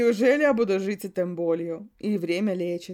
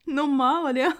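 laughing; 8 semitones higher, 5 dB louder than usual. Acted. Laughing reply in story.

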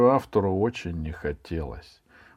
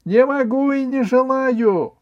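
put-on very high, loud boy's yell; desperate high-pitched outcry; defiant shout; 8 dB louder than usual. A narrator's reading. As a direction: scream the refusal in fear and defiance. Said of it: "он крикнул".

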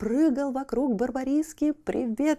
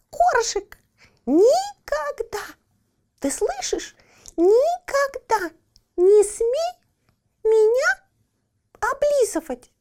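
scolding; 14 semitones higher, 5 dB louder, slower than usual. Acted. Cat scolds puppy angrily.

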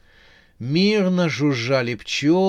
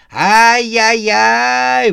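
high, loud, slow squeaky tutting; squirrel voice; 10 semitones higher, 11 dB louder, much slower than usual. A narrator's reading. Squirrel startles Max from nearby.

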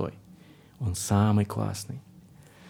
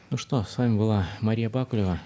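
usual speaking voice

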